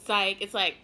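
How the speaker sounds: ordinary delivery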